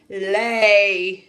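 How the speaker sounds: loud gruff order; giant's command. Acted. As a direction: bark it like a giant. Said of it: "said the giant".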